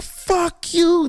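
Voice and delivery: angry yell. Retold or imitated. Angry cab driver yelling across.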